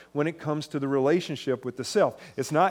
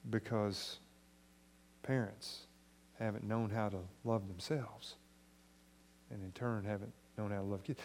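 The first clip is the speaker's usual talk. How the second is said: soft pastoral appeal; 3 semitones lower, 6 dB quieter than usual. Acted. Softer closing appeal.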